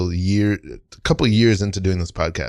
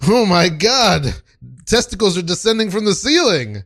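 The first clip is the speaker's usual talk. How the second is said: alarmed; 9 semitones higher than usual. Acted. Alarmed apprentice in scene.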